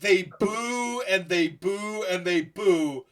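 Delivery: booing crowd. Retold or imitated. Imitating crowd booing, retold.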